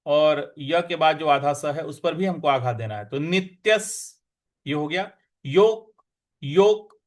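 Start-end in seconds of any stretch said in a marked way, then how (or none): 5.4-7.0 योग said loud with the half क pressed; stress on half k. Shown as a demonstration. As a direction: hit the क hard in योग. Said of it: "यहाँ जो आधा क है उस पर भी हमको आघात देना है".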